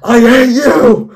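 high, loud, drawn-out scream; all-caps scream. Acted. Bill turns on Henry, all-caps scream. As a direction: stretch it out in a slow scream. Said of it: "Again, all caps".

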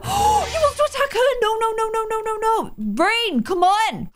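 very high, frantic protest; gasp, then plead in a high panic; panicked alarm. Real, not acted. Player begging Rain to step in for Luna, panicked alarm.

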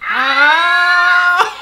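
high, loud yelp of pain; sympathetic ow. Real, not acted. For the hurt fighter, loud sympathetic ow. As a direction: wince out loud. Wincing at a painful hit.

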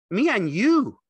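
very high, loud, impatient simple fellow; Lenny, eager and childlike. A narrator's reading. Lenny pressing George for the dream, high and loud. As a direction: press him like an excited child. Lenny thrilled about the two.

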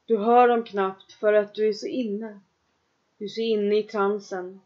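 ordinary delivery

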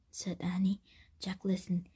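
plain reading voice

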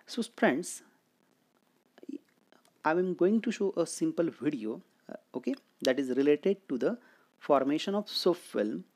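normal talking voice

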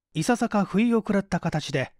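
ordinary delivery

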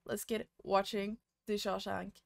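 usual speaking voice

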